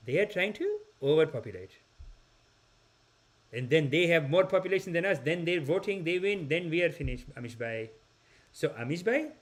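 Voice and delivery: put-on mild-mannered cousin in an Indian accent; imitated Indian accent, courteous and earnest; polite Indian accent. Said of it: "the most polite guy in the world".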